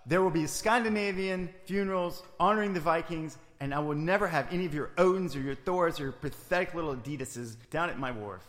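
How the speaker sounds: loud indignant tirade; angry. Acted. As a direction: rant it, offended and loud. Heated argument, louder.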